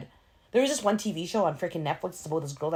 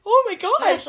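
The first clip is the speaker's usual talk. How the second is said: much higher mock horror; mock terror; 5 dB louder, slower than usual. Recalling the scary scene from the movie, mock terror. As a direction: squeal it in playful fright. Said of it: "I'm, like, in terror".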